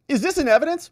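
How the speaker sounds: high-pitched, sharply questioning; mock judge's query. Judge asking whether the exhibit is in evidence, querying. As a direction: interrupt with a high, pointed question.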